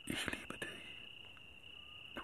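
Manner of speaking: tender unvoiced whisper. Brother whispers love to sister while sneaking out. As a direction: breathe it softly.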